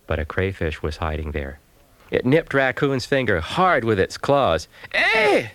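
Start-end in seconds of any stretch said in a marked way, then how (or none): 4.9-5.5 sharp high yelp of pain; sudden high pained yelp. A narrator's reading. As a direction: yelp like a bitten paw. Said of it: "Raccoon yelped".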